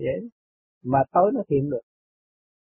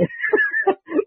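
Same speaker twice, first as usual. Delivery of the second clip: a quick airy laugh, pitched way up; amused little laugh at the monks; high breathy laugh. Real, not acted. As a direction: let a short scornful laugh slip out.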